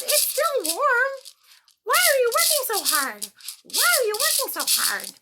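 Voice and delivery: high, loud carefree grasshopper voice; playful grasshopper. Acted. Grasshopper taunting the busy ants, high and loud. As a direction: tease the hard workers from up high. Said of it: "the grasshopper says".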